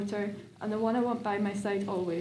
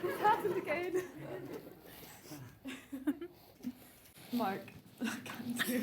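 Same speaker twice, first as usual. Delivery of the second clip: nervous laughing; 5 semitones higher, 9 dB quieter than usual. Real, not acted. Bride laughing, can't read vows.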